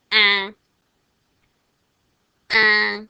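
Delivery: mock angry goose cry for children; angry goose sound. Shown as a demonstration. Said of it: "this goose is angry".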